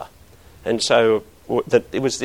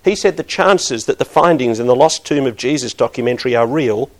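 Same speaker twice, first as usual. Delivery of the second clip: ordinary delivery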